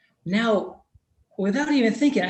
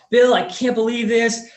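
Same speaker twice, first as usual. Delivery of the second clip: excited disbelief; 5 semitones higher, 6 dB louder than usual. Retold or imitated. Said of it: "He's so excited".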